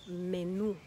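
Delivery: menú landing on a strong NÚ; menú, light first syllable, stressed last. Shown as a demonstration. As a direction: hit the final "oo" of menú. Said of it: "but we emphasize it".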